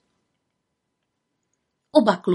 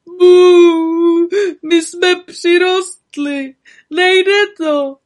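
loud, high howling sob; blubbering; 9 semitones higher, 8 dB louder, much slower than usual. Acted. Béďa stuck in the tree, blubbering. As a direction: cry it out like a child. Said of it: "Béďa Krejčích brečel".

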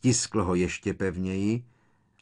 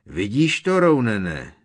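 loud, high-pitched angry thunder; booming indignant shout; 5 semitones higher, 11 dB louder than usual. A narrator's reading. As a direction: thunder it out at your companion. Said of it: "zahřímal".

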